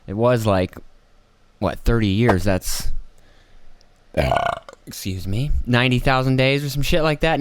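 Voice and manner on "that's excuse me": ordinary talk, nothing marked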